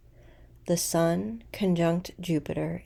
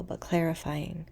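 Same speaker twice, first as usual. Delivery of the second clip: ordinary talk, nothing marked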